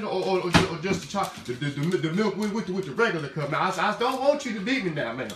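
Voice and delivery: hammed-up Southern servant, stammering and fretting; flustered subservient servant. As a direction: plead with the boss in mock fluster. Flustered servant pleading with master.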